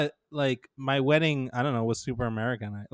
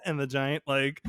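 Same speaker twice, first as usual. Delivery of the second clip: usual speaking voice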